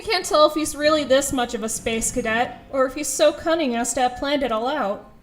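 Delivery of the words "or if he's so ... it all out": ordinary talk, nothing marked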